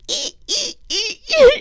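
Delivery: very high squeaked "ee" pulses; imitated squeaky blade at work; cutting noise imitation. Wart cutter starting on patient, high repeated squeaks. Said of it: "they start going".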